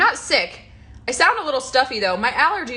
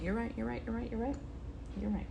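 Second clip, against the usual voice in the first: quiet mumbled; 3 semitones higher, 12 dB quieter, faster than usual. Real, not acted. Quiet repeated concession.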